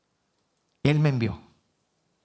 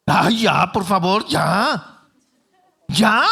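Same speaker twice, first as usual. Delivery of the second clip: louder, higher fed-up outburst; exasperated God; 4 semitones higher, 5 dB louder, much slower than usual. Retold or imitated. God fed up with Moses's excuses, exasperated. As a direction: snap out of patience. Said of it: "Entonces Jehová se enojó contra Moisés".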